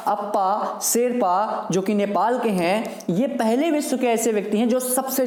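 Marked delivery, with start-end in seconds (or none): 0.1-1.7 drawn out slowly, each word spaced; slow name. Shown as a demonstration. Teacher makes students memorise the name, slow. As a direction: spell the name out slowly for the class. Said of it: "क्या नाम है इनका?".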